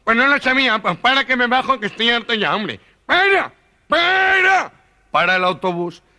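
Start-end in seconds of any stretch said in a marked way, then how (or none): 0.0-2.8 explode, done with it all; fed-up gangoso. Acted. 3.1-4.7 high, drawn-out yell of para; shouted stop. Acted. Gangoso yelling at the driver to stop, very high and slow.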